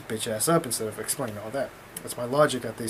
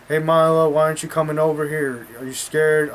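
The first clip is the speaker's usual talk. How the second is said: slightly raised, lightly imitated heckler; mock megaphone taunting; 3 semitones higher, 6 dB louder than usual. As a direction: call him out, mimicking a heckler. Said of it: "he's on the megaphone and he's like".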